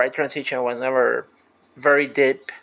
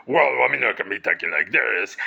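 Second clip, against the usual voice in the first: lowered put-on deep voice; 4 semitones lower than usual. Shown as a demonstration. Playing one of two people in a karaoke song, deep voice. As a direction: drop low, as the deep half of a duet. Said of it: "alternate and go from deep to high".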